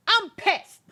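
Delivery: loud, high comic outburst; hammed-up high, loud fury; mock furious wife. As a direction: burst out in playacted anger. Voicing angry wife, then laughs.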